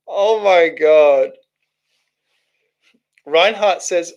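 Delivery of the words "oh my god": amused exasperation. Real, not acted. Still amused by the message.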